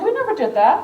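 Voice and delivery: mock the publishers' disbelief; incredulous. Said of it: "With the U S S R".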